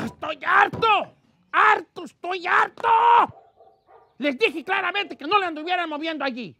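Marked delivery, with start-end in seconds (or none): 0.1-2.9 slow, loud, higher yell of ¡Estoy harto!; furious boss bellowing ¡Harto! at his staff; fed-up shout. Acted. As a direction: explode at them, drawing out ¡Estoy harto!.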